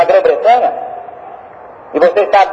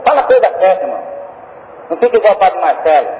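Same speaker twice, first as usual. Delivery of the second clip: ordinary talk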